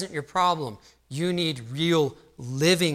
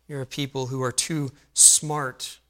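ordinary delivery

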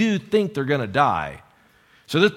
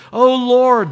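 high, loud cry of appeal; calling out to God; 8 semitones higher, 7 dB louder than usual. Acted. Desperate sailors to the Lord, calling out. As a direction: cry out in urgent prayer. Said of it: "they called out to the Lord".

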